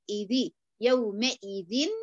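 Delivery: يومئذ stretched very slowly with the ghunna clear; slow tajweed demo of يومئذ; nasal ghunna demo. Shown as a demonstration. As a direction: drag out إذي، يومئذ and sound the ghunna from the nose. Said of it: "لا، إذي".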